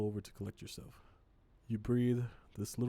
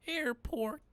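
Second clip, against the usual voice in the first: much higher questioning character voice; trying out a voice. Shown as a demonstration. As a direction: test a squeaky passenger voice. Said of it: "I don't know what his voice is gonna be".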